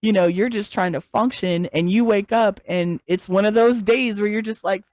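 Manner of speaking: usual speaking voice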